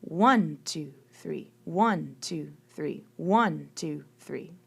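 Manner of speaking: strong downbeat on every one, the rest tucked away; counting triple meter. Shown as a demonstration. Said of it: "Triple meter would be counted like this".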